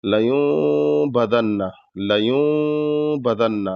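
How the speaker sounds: لیوم بدن with nun blended toward meem; nasal ghunna. Shown as a demonstration. Teacher demonstrating ikhfa nasal sound, slow. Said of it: "اس طریقے سے غنہ کرنا ہے".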